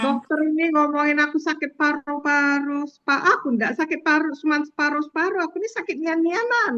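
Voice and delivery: menirukan pasien berlogat Sumsel, nada agak tinggi; logat Sumsel. Retold or imitated. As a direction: logat daerah Sumsel yang lucu. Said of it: "kalau di Sumsel lebih lucu lagi". (pasien Sumsel membantah dokter dengan logat daerah)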